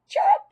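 a sharp, squeaky cricket chirrup; high cricket chirp. Acted. Boy hiding in class mimics a cricket, high squeaky chirp. Cricket noise made by Kirk.

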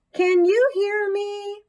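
high, slow, loud hamster call; loud squeak. Acted. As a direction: squeak it out as loud as you can. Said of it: "I squeaked as loudly as possible".

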